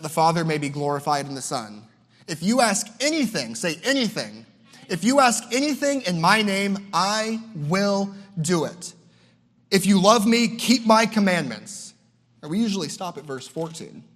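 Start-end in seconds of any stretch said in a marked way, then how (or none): 2.3-8.9 higher, stressing anything and ask; emphatic preaching. Acted. Preaching the verse. 9.7-11.9 louder, higher, slow on commandments; firm preacher's command; emphatic preaching. Acted. Preaching the verse.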